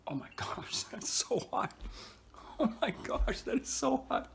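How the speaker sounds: gasping, high whispers after a hot pepper; breathless and burning from the heat; mouth burning from spicy food. Real, not acted. Bit into a habanero.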